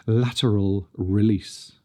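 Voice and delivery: "Lateral release" said slowly; slow and clear. Shown as a demonstration. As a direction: spell it out slowly. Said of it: "I'll say that again".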